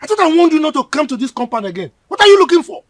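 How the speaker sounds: put-on loud, high yell at the boy; angry shout. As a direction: drive him off the compound. Uncle chasing boy from compound.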